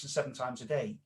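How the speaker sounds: ordinary delivery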